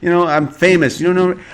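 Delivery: hammed-up who-I-am rant; mock diva. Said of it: "She pulled out the old".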